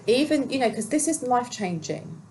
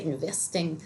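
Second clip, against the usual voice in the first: accidental, badly done South African accent; 5 semitones lower, 6 dB quieter than usual. Reader correcting the word, in a poor South African accent. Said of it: "really bad South African accent".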